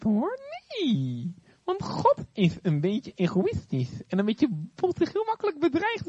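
high, sly serpent voice; snake voice. Acted. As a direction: whisper doubts like a tempting snake. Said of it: "Een beetje als een slang praat".

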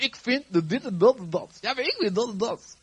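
hoog, overdreven mekkerend napraten; eigenwijze meningenspuier. Acted. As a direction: doe spottend iemand na die alleen zichzelf wil horen. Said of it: "We willen alleen maar eigen mening kwijt". (gespeeld eigenwijs gezeur)

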